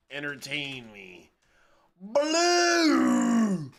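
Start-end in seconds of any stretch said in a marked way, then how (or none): 2.0-3.7 sharp loud high yell of one word; sudden shout. Real, not acted. Streamer suddenly yells at the screen, loud shout. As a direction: blurt it out as a loud call.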